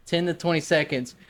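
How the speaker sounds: ordinary talk, nothing marked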